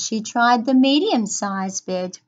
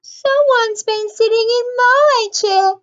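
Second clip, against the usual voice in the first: very high, loud bear cub; Baby Bear, small crying voice; 12 semitones higher, 8 dB louder than usual. A narrator's reading. Baby Bear finds someone sat in his chair, high upset cry. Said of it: "cried Baby Bear".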